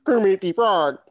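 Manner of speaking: light Kermit the Frog impression; quick Kermit-style voice. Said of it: "He can only do".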